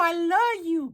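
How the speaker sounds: very high, hammed-up wail; heartbroken plea. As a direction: plead in a squeaky mock cry. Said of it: "Maria's like".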